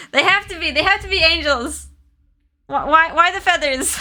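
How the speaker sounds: high, stunned squeal of realisation; excited reaction. Real, not acted. Reader reacts to story's reveal.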